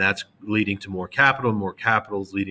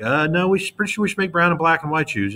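higher, hesitant objecting shareholder voice; doubtful shareholder; 7 semitones higher than usual. Acted. Teacher voicing a student shareholder objecting, higher and hesitant. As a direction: push back like an unsure shareholder.

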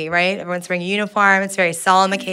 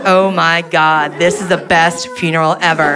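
gleeful disbelief; 3 semitones lower than usual. Retold or imitated. Delighted reaction at funeral.

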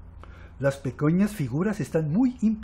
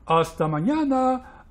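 raised goodbye, slightly stretched; drawn-out farewell; 6 semitones higher than usual. A narrator's reading. Blot saying goodbye to friends, lightly drawn-out. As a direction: call "mañana" higher, lengthening it a little. First goodbye, shorter take.

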